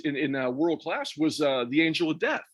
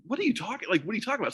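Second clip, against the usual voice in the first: retold baffled question, pitched up at the start; mildly incredulous, a touch softer; mock disbelief; 6 semitones higher, 3 dB quieter, much faster than usual. Acted disbelief at friend's claim.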